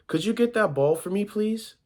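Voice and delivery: high-pitched polite request, lifting at the end; small boy asking. A narrator's reading. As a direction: ask like a little boy. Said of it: "So was the small boy".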